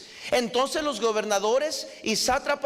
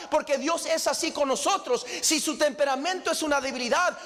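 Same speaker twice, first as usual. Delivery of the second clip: ordinary talk, nothing marked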